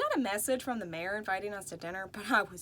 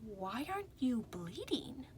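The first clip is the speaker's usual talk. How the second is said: whisper; 5 dB quieter than usual. A narrator's reading. Doctor leans close, puzzled.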